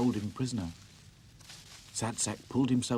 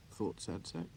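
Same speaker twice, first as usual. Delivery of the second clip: ordinary delivery, nothing marked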